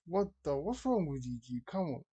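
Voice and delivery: ordinary talk, nothing marked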